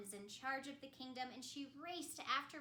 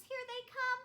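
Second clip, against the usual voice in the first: high, louder rising alarm; 11 semitones higher, 6 dB louder than usual. Acted. Elsa feeling her powers rising, alarmed. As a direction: panic building up.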